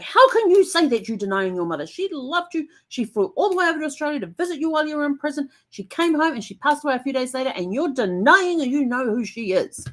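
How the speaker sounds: lecturing the dead uncle in the book; irate tirade. Retold or imitated. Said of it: "I was getting irate".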